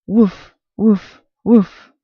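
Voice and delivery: loud, high barking woof; dog barking woof. A narrator's reading. Narrator barking as the dog Pal. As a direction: bark like a small dog. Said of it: "Pal barked at him".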